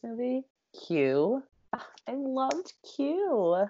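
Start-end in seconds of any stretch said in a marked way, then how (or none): 1.7-3.6 gushing. Real, not acted. Gushing over a favourite character.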